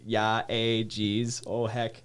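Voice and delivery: mock Fargo Canadian accent with "eh"; hammed-up Minnesota-Canadian accent. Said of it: "the Canadian accent in there".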